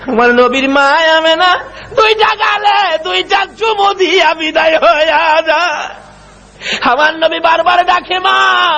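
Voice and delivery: high, ringing chanted lament; lamenting waz climax. Acted. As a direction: cry out the tale in long held high notes. High wailing climax of story. (চড়া সুরে বিলাপের ওয়াজ)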